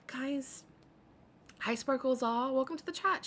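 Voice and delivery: high, sing-song mocking imitation of her chat greeting; mocking streamer greeting. Streamer mocking a rival's sugary greeting to chat, high and sing-song. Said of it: "remember when she said".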